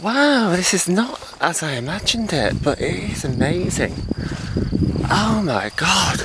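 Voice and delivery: overwhelmed by the view, gushing; real awe at the hilltop view; awestruck. Real, not acted.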